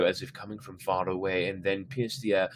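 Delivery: ordinary delivery, nothing marked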